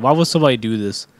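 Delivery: ordinary talk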